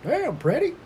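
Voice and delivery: high, loud Southern drawl, imitating Todd Fogle; Southern accent impression. Imitating his Southern friend's praise, loud Southern accent. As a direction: ham up a Southern friend's exclamation. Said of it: "His, his accent ain't that extreme".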